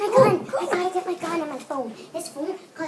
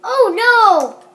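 loud, high cry of dismay; dramatic outcry; 6 semitones higher, 10 dB louder, much slower than usual. Pretend. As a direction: wail it out loud. Dramatic outcry mid-video.

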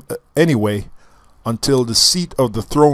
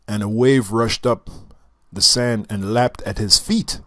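normal talking voice

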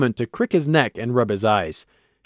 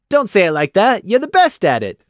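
higher voice, buttering him up without meaning it; false praise; 7 semitones higher, 4 dB louder than usual. Acted. Gloom coaxes Prey with false praise, slightly high. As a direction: flatter insincerely. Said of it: "with false praise".

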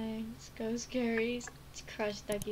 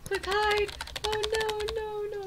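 mock panic; 9 semitones higher than usual. Hiding from mom in game.

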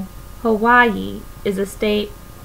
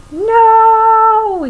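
high, loud desperate yell; 11 semitones higher, 8 dB louder than usual. A narrator's reading. Pleakley as Jumba drives through the fruit stand, high loud yell. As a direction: bellow it high as the stand breaks. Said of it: "yelled Pleakley".

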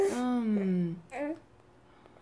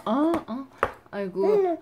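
usual speaking voice

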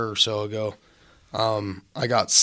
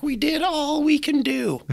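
much higher, slow whiny mock voice; mocking contractors. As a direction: ham up the lame excuse in a high whine. Contractors' excuse mocked.

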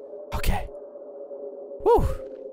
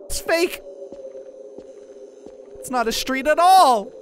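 high indignant cry, louder at the end; mock outrage; 9 semitones higher, 5 dB louder than usual. Mock outrage at fake street.

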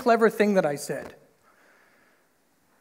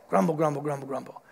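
low, muttered grumble fading away; mock grumbling; 5 semitones lower, 5 dB quieter, faster than usual. Israelites grumbling after God's gifts, low mock muttering. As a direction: grumble under your breath, trailing off.

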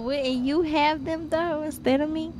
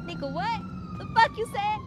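high-pitched tough-guy challenge; mock threat; 5 dB quieter than usual. Shown as a demonstration. Friend practicing the tough act, high mock threat. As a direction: try to sound menacing. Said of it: "Your turn".

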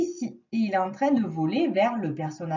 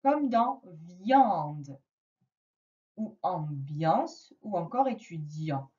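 1 very slow example; 4 semitones lower, slower than usual. Shown as a demonstration. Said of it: "je vois I A N".

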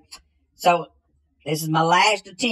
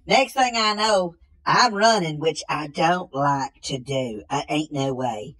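ordinary talk, nothing marked

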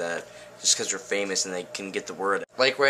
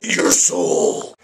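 scary demon voice; 4 semitones lower, 8 dB louder than usual. Pretend. Scary interruption.

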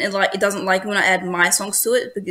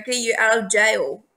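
ordinary talk

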